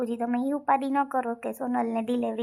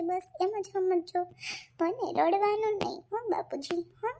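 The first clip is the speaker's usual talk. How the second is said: high-pitched, tender parting plea; emotional farewell; 4 semitones higher than usual. Acted. Daughter-in-law saying goodbye to father, emotional farewell. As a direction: hold back tears while reassuring him.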